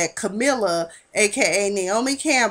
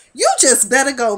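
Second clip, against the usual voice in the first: a firmer, louder telling-off; stern warning; 4 semitones higher, 6 dB louder than usual. Retold or imitated. Warning Ryan to leave, in a louder stern voice. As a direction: push him out the door with it.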